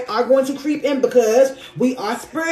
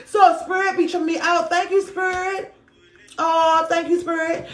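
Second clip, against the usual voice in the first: overcome, thankful; 5 semitones higher than usual. Real, not acted. Card reader thanking Spirit after being moved, high and tearful. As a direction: thank Spirit in a raised, emotional voice.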